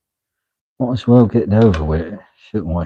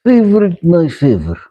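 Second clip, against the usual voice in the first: excited; 8 semitones higher, 3 dB louder than usual. Real, not acted. Excited about the new piece.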